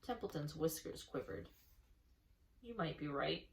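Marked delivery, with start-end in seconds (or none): 2.6-3.5 gruff rat. A narrator's reading. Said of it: "he said gruffly".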